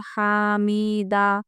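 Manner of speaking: हामीदा with the ई stretched long; wrong long vowel. Shown as a demonstration. Teacher showing pupils the wrong reading हामीदा. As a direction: say हामीदा dragging the middle ई. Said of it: "ऐसा पढ़ेंगे या हामिदा पढ़ेंगे".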